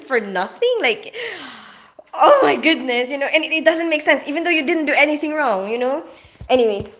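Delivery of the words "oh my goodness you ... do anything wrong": ordinary delivery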